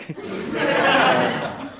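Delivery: high bright burst of laughter. Real, not acted. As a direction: laugh it out, amused. Laughs at [name] joke.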